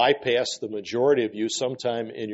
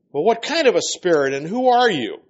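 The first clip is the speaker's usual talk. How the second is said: higher, pressing questions; demanding questioning; 7 semitones higher, 4 dB louder than usual. Acted. Healer challenging the spirit to name itself, demanding. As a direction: press the demon for answers.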